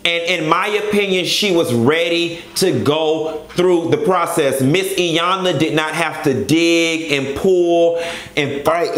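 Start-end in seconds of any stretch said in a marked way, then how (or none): none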